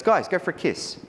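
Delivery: tossed-off kiss cue, confident and casual; Jerry's easy order. Retold or imitated. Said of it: "he goes".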